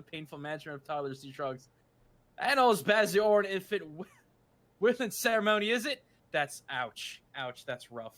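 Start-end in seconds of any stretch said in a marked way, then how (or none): 2.4-6.0 louder, mock-British dismissive patter; pseudo-British accent. Tattooist answering about needles and toddlers, pseudo-British accent. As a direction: shrug it off in a fake British accent. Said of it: "with the pseudo-British accent".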